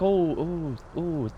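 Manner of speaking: trying out Welsh vowels; attempted Welsh accent. Shown as a demonstration. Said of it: "in a Welsh accent".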